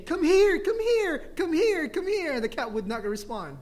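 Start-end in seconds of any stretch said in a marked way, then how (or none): 0.0-2.3 put-on high-pitched, louder kids calling a cat. Visiting kids calling the cat to play, kids calling. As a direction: mimic eager children calling a pet, repeatedly. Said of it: "the kids kept saying".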